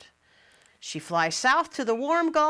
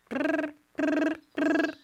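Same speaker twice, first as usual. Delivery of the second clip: shivering brr; 7 semitones higher, slower than usual. Acted. Shivering sound.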